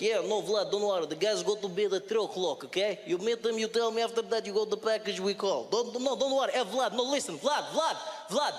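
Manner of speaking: put-on Russian-accented English, insistent phone chatter; urgent Russian accent, rising in pitch. Russian guy on the phone reassuring Vlad, Russian-accented English. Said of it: "the English-Russian accent".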